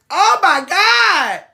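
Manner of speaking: high, loud, very slow disbelief; reacting to diners praising bad food; slow incredulous oh my God. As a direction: drag it out in disbelief. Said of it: "I'm like".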